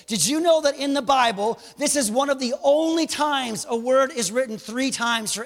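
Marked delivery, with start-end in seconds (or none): none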